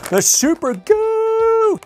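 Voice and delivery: high, loud, stretched-out hype; dramatic announcement. Pretend. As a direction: draw out the name like a big reveal. Dramatic toy name announcement.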